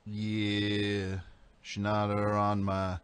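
low, slow singer impression; Kurt impression. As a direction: croon it like Kurt. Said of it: "Sounds just like him".

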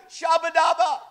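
loud mock tongues, laughing. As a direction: belt out the gibberish with a laugh. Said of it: "bishop go".

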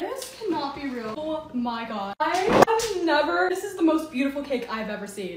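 hushed, slow disbelief; soft, lingering amazement; stunned awe. Real, not acted. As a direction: draw it out softly, overwhelmed. Stunned at opening a surprise cake.

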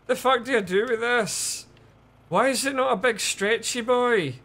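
loud, high-pitched exasperation; frustrated outburst. Real, not acted. Player stuck with a cord that won't reach, frustrated yelling. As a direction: yell your bafflement at the game.

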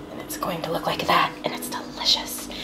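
secretive whisper. Acted. Sharing dirty little secret.

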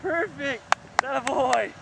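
high, loud cheering praise; excited praise. Real, not acted. Praising the pilot's landing, high and loud with excitement. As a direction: cheer him on like a proud coach.

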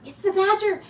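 high, loud startled squeak; frightened mouse. A narrator's reading. Mouse spots the badger, loud high alarm. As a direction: Mouse jumps at the rustling sound. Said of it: "jumping in the air".

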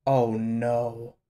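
low, soft "oh no"; dismayed dread. Real, not acted. Viewer sees a dreaded character return, quietly dismayed. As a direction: sink into a hushed, real dismay. Dreading a twist while watching.

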